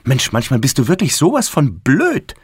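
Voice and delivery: put-on cross, exasperated girl; exasperated scolding. A narrator's reading. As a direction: berate a silly brother. Sister berates brother.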